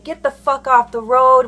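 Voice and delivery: annoyed retelling of a driver's outburst; retold freak-out. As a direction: imitate an irate driver, half shouting. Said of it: "he freaks out saying".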